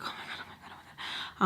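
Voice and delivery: hushed, repeated acted panic; barely audible frightened muttering; panicky whisper. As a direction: whisper it frantically to yourself. Acting out panic while hiding.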